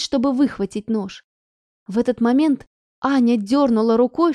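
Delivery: plain reading voice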